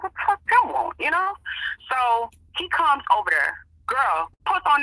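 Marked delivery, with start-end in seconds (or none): 0.0-1.0 high-pitched exasperated outburst; irritated disbelief. Retold or imitated. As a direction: toss it off in annoyed disbelief. Irritated reaction to Tim coming over.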